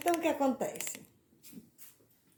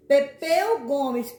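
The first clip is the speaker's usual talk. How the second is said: louder; 8 dB louder, slower than usual. Shown as a demonstration. ("[name]" mais alto, com ênfase em "Pepeu")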